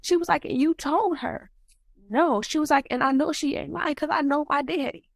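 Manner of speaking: very high, upset voice; emotional. Real, not acted. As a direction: relive a painful family call, pitch climbing with feeling. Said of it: "I'm gonna get emotional".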